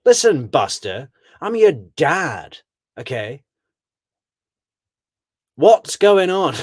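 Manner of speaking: hammed-up angry father; mock stern dad. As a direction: lay down the law as the big dad. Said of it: "He's like".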